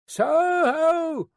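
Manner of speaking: high, long sung-out hail; roared hail. A narrator's reading. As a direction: sing it out to carry through the mist. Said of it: "sang out, as loud as he could roar".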